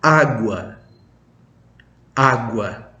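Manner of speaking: Agua with the stress on the first a; Portuguese agua. Shown as a demonstration. Teacher contrasts Indonesian aqua with Portuguese Agua. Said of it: "aksennya harus demikian".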